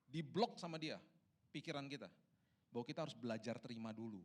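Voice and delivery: ordinary talk, nothing marked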